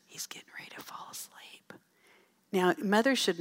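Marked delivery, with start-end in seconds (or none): 0.0-1.7 a hushed, breathless whisper in the pew. Retold or imitated. Mother whispers in church as her son nods off. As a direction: lean over and whisper it so nobody hears.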